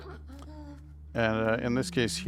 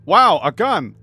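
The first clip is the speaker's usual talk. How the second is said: loud, high burst of delight; excited exclamation; 7 semitones higher, 13 dB louder, slower than usual. Real, not acted. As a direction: blurt it out, thrilled to find a gun. Finds a gun in game.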